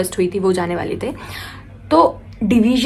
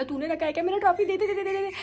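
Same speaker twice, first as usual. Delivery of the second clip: teary demand; 9 semitones higher, 10 dB quieter, faster than usual. Retold or imitated. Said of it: "अब मैं रोए जा रही हूँ".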